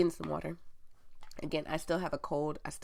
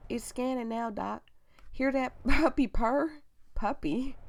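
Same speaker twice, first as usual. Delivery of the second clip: high, hammed-up country nurse; country accent; 6 semitones higher, 5 dB louder than usual. Nurse chatting to Doc during the scan, country accent. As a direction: put on a butchered country twang, pitched up. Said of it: "I'm gonna give him a country accent".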